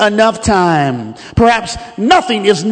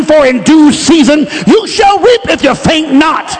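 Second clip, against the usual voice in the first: raised, driving preacher's cry; full-force preaching; 4 semitones higher, 4 dB louder than usual. Real, not acted. Preacher urging listeners to endure the process of time, impassioned preaching. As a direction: build to a loud, high exhortation.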